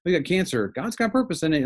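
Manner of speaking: high, breezy cliché voice; trite platitude. Retold or imitated. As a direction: say it like an empty cliché. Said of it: "you don't wanna say".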